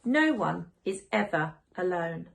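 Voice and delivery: plain reading voice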